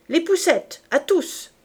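loud, high command; shouted order. A narrator's reading. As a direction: cry out an order to the whole squad. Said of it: "cria Javert".

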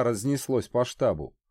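ordinary delivery, nothing marked

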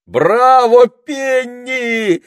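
very high, loud bravo; ringing high shout; shouted cheer. A narrator's reading. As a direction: cry out in delighted applause. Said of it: "закричал Питер".

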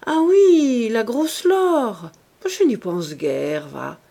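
a scornful pout, high and loud at the start, trailing off dismissively; loud disdainful pout fading to a shrug. A narrator's reading. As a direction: brush the rival aside with a sniff. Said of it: "avec sa moue de dédain".

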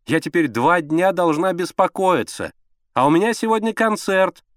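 high, whiny complaint; petulant woman, pitched high; capricious. A narrator's reading. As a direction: fret peevishly at your husband. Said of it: "капризным голосом ответила Алла Сергеевна".

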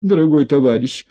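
high, whining plea; whiny. A narrator's reading. Одутловатый плаксиво перебивает инспектора. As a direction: whimper it out like a cornered man. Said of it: "перебил плаксивым голосом одутловатый".

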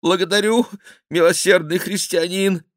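high, loud desperate cry; desperate thanks. A narrator's reading. The despairing king thanking the cardinal, high and desperate. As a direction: clutch at mercy. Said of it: "воскликнул исполненный отчаяния король".

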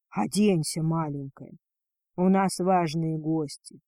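ordinary delivery

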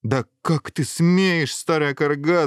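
indignant monarch raising his voice; outraged king's shout. Acted. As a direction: thunder at the witch. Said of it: "закричал король".

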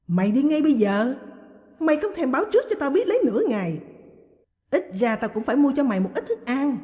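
loud, high, rushed shriek. A narrator's reading. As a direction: wail it out as if stabbed. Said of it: "rú lên như bị ai đâm vào cổ".

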